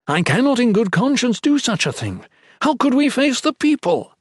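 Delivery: high, loud indignant padre; outraged padre. Acted. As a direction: refuse on principle, voice rising high. Ethan refuses in outrage.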